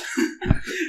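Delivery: high, airless burst of laughter; real laugh, high and breathless. Real, not acted. Laughing at absurd story.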